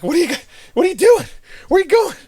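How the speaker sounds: imitating a panicked gunny, voice shooting up; gunny yelling at the battalion leaving without glasses, frantic and high; freaking out. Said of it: "He's, like, freaking out".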